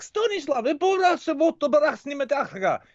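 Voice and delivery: high, fast mock Russian gabble; mock Russian mom. Teller imitating a Russian mother scolding her son, high. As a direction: voice his friend's mom scolding in fake Russian. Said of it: "She's like".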